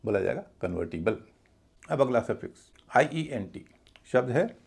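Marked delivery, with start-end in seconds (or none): 0.6-1.2 "कन्वर्टिबल" as kun-VUR-ti-bul; light first vowel. Shown as a demonstration. Teacher models the rule, "कन्वर्टिबल" with reduced first vowel. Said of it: "ओ न होके अ होगा".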